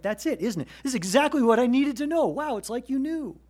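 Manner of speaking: a believer thrilled the verse fits the moment; excited realization. Retold or imitated. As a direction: burst out in amazed discovery. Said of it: "you're like".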